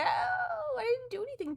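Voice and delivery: high drawn-out mock cry; wailing at the cat in jest. Cat bites her.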